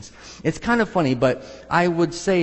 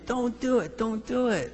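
warning plea; 7 semitones higher, 4 dB quieter than usual. Retold or imitated. Loved ones warning against God's call.

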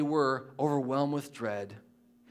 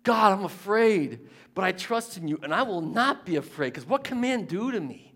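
loud, higher, slowed plea to God; fearful David; 6 semitones higher, 6 dB louder than usual. Retold or imitated. Preacher acting David crying out to God, loud and pleading. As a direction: cry out the fear, then the trust. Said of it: "It's a refrain in his life".

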